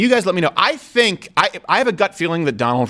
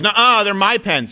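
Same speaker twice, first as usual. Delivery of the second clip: higher, drawn-out schoolboy protest; childish denial; 5 semitones higher than usual. Retold or imitated. Accused classmate denying the theft to the teacher, childish mock protest. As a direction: imitate a kid stubbornly insisting, slowly. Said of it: "And Daniel goes".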